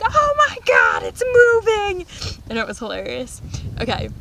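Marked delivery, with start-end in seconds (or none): 0.0-2.1 high, loud mock scream; retold scream. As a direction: shriek it, drawn out. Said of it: "like, screaming".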